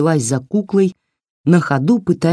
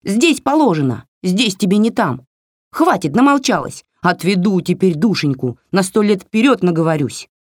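higher, snappy little doll telling her off; scolding doll voice; 5 semitones higher than usual. Acted. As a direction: scold like a cheeky tiny doll. Doll rants. (высокий бранчливый голосок куклы)

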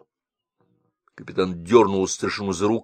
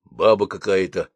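ordinary delivery

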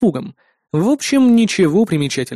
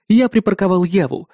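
plain reading voice

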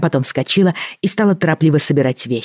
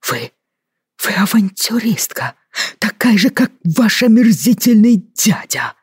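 gasping, higher furious outburst; breathless shout; 7 semitones higher than usual. A narrator's reading. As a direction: choke out a furious yell. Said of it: "задыхаясь, выкрикнул он". (задыхаясь, выкрикивает)

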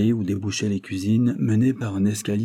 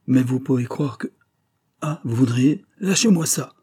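higher voice, rising to a sharp command; alarmed interruption; 4 semitones higher, 4 dB louder than usual. Acted. Inspector grabbing the young man's revolver, in alarm. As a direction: break off and order it urgently.

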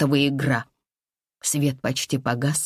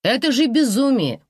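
higher, louder cry of disbelief; indignant outcry; 8 semitones higher, 4 dB louder than usual. Acted. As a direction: exclaim it in disbelief. Heroine protests indignantly.

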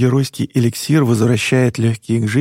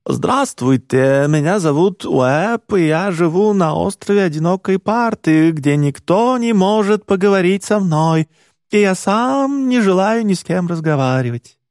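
put-on British accent; 6 semitones higher than usual. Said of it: "штука с британским акцентом".

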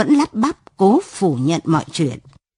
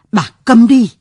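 angry snap; 2 semitones higher, 6 dB louder than usual. A narrator's reading. Father snaps at pleading wife.

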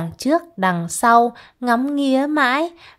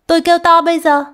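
higher and louder warning; alarmed threat; 8 semitones higher, 7 dB louder, much faster than usual. Acted. Chị Tam warns Ván Cách she'll scream, high loud threat. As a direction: raise the voice in a sharp warning.

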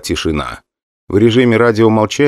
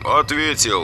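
over radio; 7 semitones higher, 6 dB quieter, slower than usual. A narrator's reading. Narrator answers on radio.

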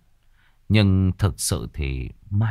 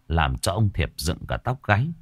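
ordinary delivery

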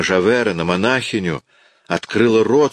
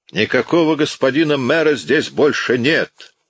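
gloating shout; 7 semitones higher than usual. A narrator's reading. Javert gloats after laughing.

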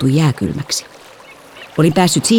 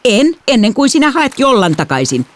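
higher, heated refusal; cross, raised-pitch retort; angry refusal; 10 semitones higher than usual. A narrator's reading. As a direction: refuse firmly in a squabble. Refuses in quarrel, holding book away.

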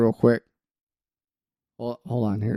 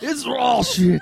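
higher, laughing mock chef shout; mock Gordon Ramsay yell; 6 semitones higher, much slower than usual. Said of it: "Like, Gordon".

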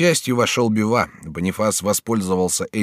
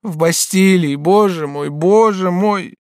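high, loud lament; anguished wail; 12 semitones higher, 7 dB louder, slower than usual. Acted. Batilda hears he is in the Bastille, anguished. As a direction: wail it, stretching the last appeal. Batilda learns Raoul is imprisoned.

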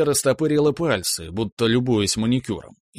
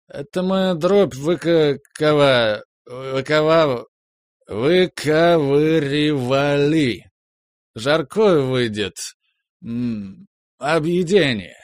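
put-on slow, stumbling drunk speech; drunk slurring; 3 semitones higher, 4 dB louder, much slower than usual. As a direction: trip over the words tipsily. Drunk husband stumbles over word. (пьяная запинающаяся речь)

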